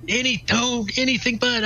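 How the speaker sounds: high mock voice of a reassuring old priest; imitating old priest. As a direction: lightly mimic the eighty-year-old priest cutting him off. Retelling the old priest's reply.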